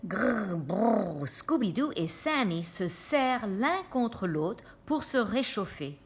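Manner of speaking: ordinary delivery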